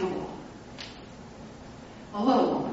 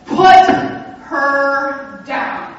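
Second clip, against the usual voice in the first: slow, drawn-out firm order; firm command; 5 semitones higher, 11 dB louder, much slower than usual. Retold or imitated. Pastor orders the big man to drop her, slow loud command. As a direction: plant each word, slow and loud.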